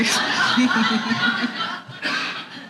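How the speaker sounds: unforced laugh after an aside; knowing laugh. Real, not acted. Storyteller laughs as audience guesses where it's headed, amused laugh. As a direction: laugh along with your listeners.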